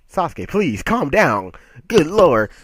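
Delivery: high, loud begging, building to the end; exasperated mock plea. Fan begs Sasuke to calm down, exasperated mock plea. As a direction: plead loudly, hammed-up exasperation.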